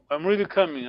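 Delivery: usual speaking voice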